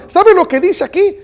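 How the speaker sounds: high, eager acted question; retold excitement. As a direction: play the excited young man asking his pastor. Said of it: "lo vio todo emocionado". (imitando a un joven emocionado)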